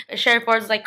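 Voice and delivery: ordinary talk, nothing marked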